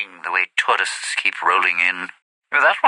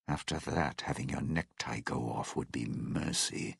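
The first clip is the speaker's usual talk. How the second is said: low, hushed and ominous; softly foreboding; grim warning; 9 semitones lower, 8 dB quieter than usual. Acted. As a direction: drop low and quiet for a dark warning. Grim warning about being captured.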